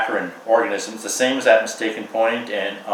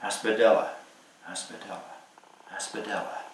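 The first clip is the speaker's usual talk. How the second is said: fading whisper, softer each time; 10 dB quieter than usual. Acted. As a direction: breathe the name like a secret. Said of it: "we'll whisper".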